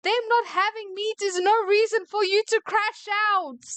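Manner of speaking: high-pitched angry outburst; mad rant. Real, not acted. Worker scolding a customer over a crash out, mad. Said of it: "I'm mad".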